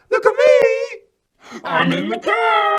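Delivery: put-on very high, loud, slow little boy's shout; toddler calling out. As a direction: call out like a thrilled three-year-old. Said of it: "called out".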